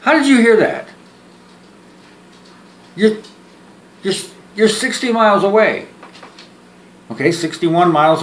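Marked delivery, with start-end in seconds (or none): 0.0-5.9 astonished. Acted. Stunned by caller's news.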